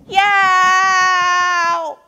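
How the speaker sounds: high, loud, long yowl; drawn-out high scream; tantrum scream. A narrator's reading. Turtle answers Hippo with a screamed yowl. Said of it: "screamed Turtle".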